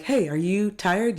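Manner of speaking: high, sing-song fake concern; sweetly pushing them to leave; fake concerned friend. Acted friend at club.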